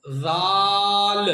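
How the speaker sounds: जाल as zaal with a very long, level vowel; thin letter, not heavy. Shown as a demonstration. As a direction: model जाल thin, held on one note. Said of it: "मोटा नहीं पढ़ेंगे".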